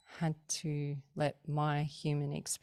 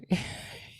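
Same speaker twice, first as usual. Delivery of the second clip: breathy unvoiced giggle. Real, not acted. Laughing at the memory.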